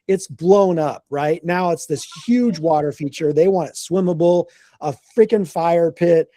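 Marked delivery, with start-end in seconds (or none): none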